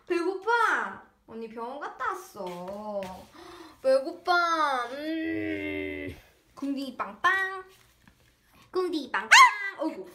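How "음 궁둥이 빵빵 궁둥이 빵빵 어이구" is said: normal talking voice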